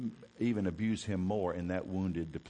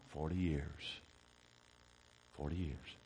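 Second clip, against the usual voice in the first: hushed; 4 semitones lower, 9 dB quieter than usual. Real, not acted. Hushed repeated answer for effect.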